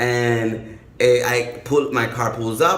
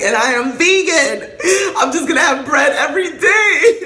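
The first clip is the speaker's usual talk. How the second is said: hammed-up freak-out; 3 semitones higher than usual. Storyteller reliving fear of jail, hammed-up freak-out. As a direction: whine it, then escalate into squealing mock despair. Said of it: "I was, like, really freaked out".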